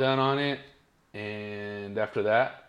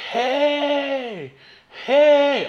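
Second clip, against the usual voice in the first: high, loud drawn-out holler; yelled warning; 9 dB louder than usual. Retold or imitated. Guy in a truck hailing a driver who's stuck, yelling. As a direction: holler it like a man calling from a truck.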